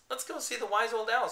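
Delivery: high, loud custardy voice; yellow voice. Acted. Painted bear suggesting owl, yellow-sounding. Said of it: "his voice was so yellow".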